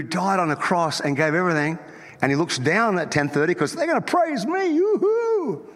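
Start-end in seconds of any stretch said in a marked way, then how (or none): 3.7-5.7 squeaky high-pitched mock call; mock high yoo-hoo. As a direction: sing it out like a silly greeting. God voiced mocking latecomers.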